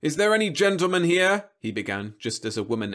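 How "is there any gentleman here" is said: high, loud pitch to the crowd; smooth TV-host summons; robot salesman calling. A narrator's reading. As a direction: call out for a customer. Robot seeking new customer.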